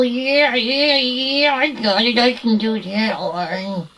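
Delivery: throaty, easygoing puppet voice; throat voice. Shown as a demonstration. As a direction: talk on the throat. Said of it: "you go like this on the throat".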